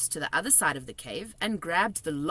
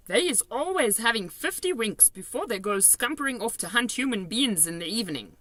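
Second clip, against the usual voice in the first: kindly giant, raised voice; BFG giant voice; 6 semitones higher than usual. A narrator's reading. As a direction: play the big friendly giant, chatty and odd. BFG voiced in read story.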